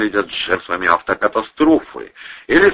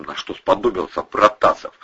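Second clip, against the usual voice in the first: ordinary delivery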